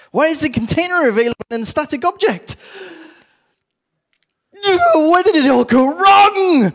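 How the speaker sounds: mock screaming rant. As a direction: rant at the code like it's the end of the world. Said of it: "Why am I screaming at a fluffy toy?".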